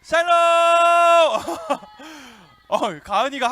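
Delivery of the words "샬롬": high, drawn-out rallying shout; shouted greeting. Real, not acted. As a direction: call it out loud, stretching each word. Leads a shouted group greeting.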